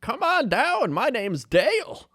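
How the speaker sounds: high put-on old man welcome; imitating Dale. Retelling an old man waving them into his lot, high put-on welcome. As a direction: beckon them in like a folksy old attendant. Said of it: "he was like".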